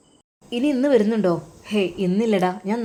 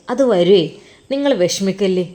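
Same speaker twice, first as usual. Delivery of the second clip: usual speaking voice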